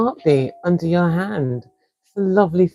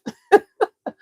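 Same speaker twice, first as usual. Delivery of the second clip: short laugh. Real, not acted. Laughing at own accent.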